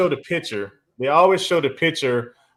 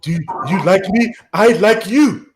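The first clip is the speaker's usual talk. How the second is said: higher, deliberate wooing in a put-on African accent; mock African accent; 6 semitones higher, 4 dB louder, slower than usual. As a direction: flirt as the imitated African woman. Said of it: "Why your voice so deep?".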